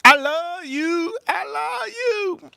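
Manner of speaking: high slow mimicry of a woman's loving thanks; imitating her I love you. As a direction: squeal it high and slow like she did. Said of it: "she said".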